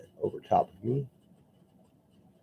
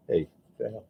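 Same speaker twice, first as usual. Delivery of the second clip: ordinary delivery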